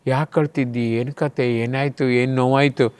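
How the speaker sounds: softly asking after someone crying; soft, sympathetic questioning; concerned passer-by. Acted. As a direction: lean in with hushed, gentle concern. Passers-by consoling someone who is crying.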